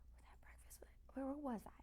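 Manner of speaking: a mumbled whisper, lost in thought; quietly wondering to herself off mic; whispered to self. Real, not acted. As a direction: whisper it as a private aside, trying to remember. Losing her train of thought.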